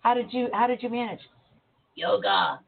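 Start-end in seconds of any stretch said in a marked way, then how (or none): 2.0-2.6 very deep croaking frog voice. Retold or imitated. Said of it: "You know, in frog voice".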